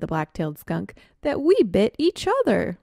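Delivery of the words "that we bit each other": dawning realization. Acted. Realizing they bit each other.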